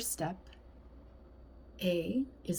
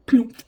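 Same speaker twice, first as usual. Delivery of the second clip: loud plump sound; 4 semitones higher, 13 dB louder than usual. A narrator's reading. Sound effect word in story.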